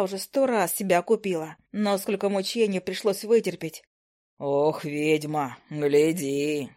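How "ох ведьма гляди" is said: low, slow gnome mutter. Acted. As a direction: mutter a playful warning. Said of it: "с улыбкой пробормотал гном".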